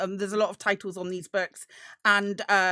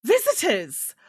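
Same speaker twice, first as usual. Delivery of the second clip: higher, brisk librarian voice, a little harried; a lightly voiced, flustered woman, higher than usual; flustered scribe; 3 semitones higher, 3 dB louder than usual. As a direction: play the busy scribe apologising for the mess. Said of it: "she's like".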